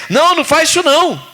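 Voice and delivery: lightly voiced God forbidding; God's voice. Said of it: "Deus não fala assim".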